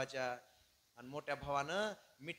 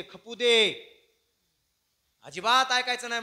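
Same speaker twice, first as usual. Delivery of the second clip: loud, higher-pitched quarrelling; angry refusal; 7 semitones higher, 15 dB louder than usual. Acted. Wife refusing to let her husband go, loud and angry. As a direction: shut him down forcefully.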